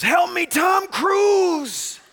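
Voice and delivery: high, long-held loud yell; screaming for help. Retold or imitated. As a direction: scream the plea and stretch the name out. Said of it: "he's screaming".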